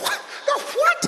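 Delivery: high, incredulous fifty-four million; mock disbelief. Host laughing off the rumour to the crowd, squeaking in disbelief.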